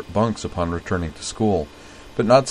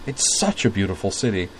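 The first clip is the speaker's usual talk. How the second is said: ordinary delivery, nothing marked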